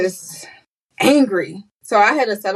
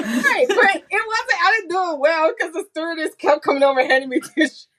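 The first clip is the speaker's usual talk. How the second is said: much higher, talking through laughter; laughing; 9 semitones higher than usual. Real, not acted. Guest laughs while recalling crying on the plane. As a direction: giggle through the words.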